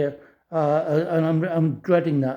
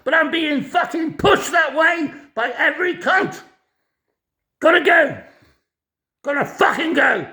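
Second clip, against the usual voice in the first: furious shout; 8 semitones higher than usual. Real, not acted. Man signing off his angry video rant, furious shout. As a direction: shout it out, fed up with everyone. Ranting that everyone pushes him.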